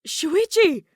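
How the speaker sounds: high, loud cry of the name; relieved exclamation. Acted. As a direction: call out his name in relief. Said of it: "exclaimed in relief".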